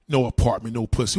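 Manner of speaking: scornful loud quote of the comment; mocking repeat. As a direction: throw their words back at them.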